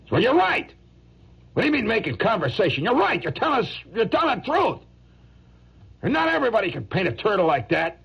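high-pitched, worked-up protest; indignant outburst. Acted. Touchy turtle painter rejecting small talk, high indignant outburst. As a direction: protest in a high voice, stung and insistent.

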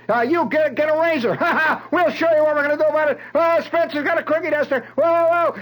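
high, loud rowdy barracks hollering; whooping, high-pitched mob ringleader; mob cheering and yelling. Retold or imitated. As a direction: shout orders and taunts over a cheering crowd. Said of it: "they're cheering and yelling".

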